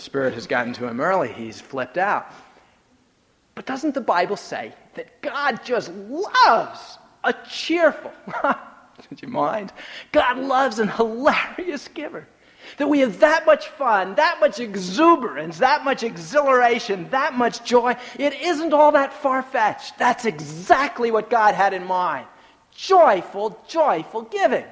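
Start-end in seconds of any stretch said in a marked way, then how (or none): 3.5-12.3 mock incredulous build. Preacher building to the punchline. 12.8-24.6 high, loud, exuberant sermon climax; preaching at full force. Acted.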